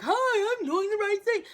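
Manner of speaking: high, loud overeager chirp; obnoxious eager voice. Shown as a demonstration. Showing how not to stand out, high loud overeager chirp. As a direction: play the obnoxious show-off brightly. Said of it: "No, not like that".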